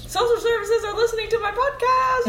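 laughing. Real, not acted. Mock outrage about the podcast.

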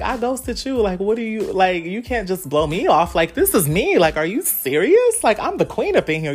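high-pitched, incredulous protest; indignant inner voice. Retold or imitated. Queen protests being blown off, high indignant disbelief. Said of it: "in my head I was like".

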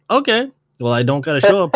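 mock-casual brush-off to the teacher; dismissive shrug. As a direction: toss it off like it's no big deal. Shrugging off failing teacher's warning.

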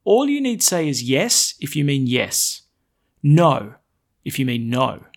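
loud, solemn scripture reading; gospel proclamation. Acted. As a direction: proclaim it to the whole church. Priest proclaims gospel aloud.

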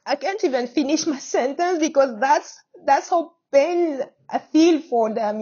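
choked up, trying to get through the sentence; holding back tears. Real, not acted. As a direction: voice breaking, fighting to steady it. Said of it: "I'm getting emotional".

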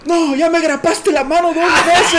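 very high, drawn-out wail of pain; mock screaming. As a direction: fake victim yelling for the neighbours. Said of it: "me ponía yo a gritar en el patio". (alarido fingido y agudo)

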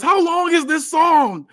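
high, slow, drawn-out yell; yelled complaint. Acted. Wrestler being jabbed yells at the music, high and slow. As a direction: stretch the yell out in disbelief. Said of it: "Cheeseburger yells".

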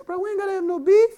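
quick high-pitched street voice; high casual voice. Retold or imitated. Preacher voices a peacemaking quote.

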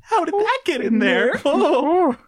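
very high, squeaky fake astonishment; dorky feigned surprise. Peter rehearsing for MJ finding the ring, dorky feigned surprise. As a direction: overdo innocent surprise like a little dork. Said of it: "sell being a little dork".

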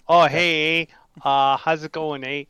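mock Canadian hockey accent, loud and cheery. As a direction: play the friendly Canadian hockey kid. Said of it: "I feel like I was on set for Letterkenny".

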